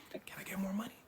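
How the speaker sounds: whispered, sneaky question; hushed request. Retold or imitated. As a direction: slip it in under your breath. Partner first asks under breath.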